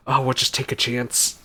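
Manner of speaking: normal talking voice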